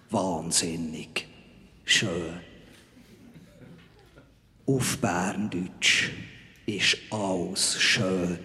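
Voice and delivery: low, slow, solemn proclamation; deep ceremonial heavenly messenger; Archangel Gabriel. Acted. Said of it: "Rolle als Erzengel Gabriel".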